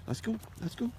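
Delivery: talking around a whistle in the mouth. Real, not acted. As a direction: say it with a whistle between the lips. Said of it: "I got my whistle in my mouth, so you're gonna hear me talking a little funny".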